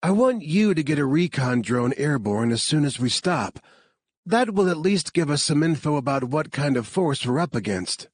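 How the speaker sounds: lightly voiced commander giving orders; character voice. A narrator's reading. Commander briefing the team before the stop, lightly voiced.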